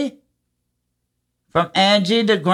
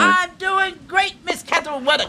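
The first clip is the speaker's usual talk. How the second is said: excited; 11 semitones higher than usual. Real, not acted. Radio host greets caller excitedly.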